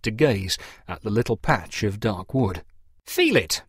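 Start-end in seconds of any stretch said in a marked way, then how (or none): 3.1-3.6 higher, brisk command; ordering. A narrator's reading. Parson to the farmers, a higher brisk order. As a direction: order them to touch it. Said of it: "Mister Boggis ordered".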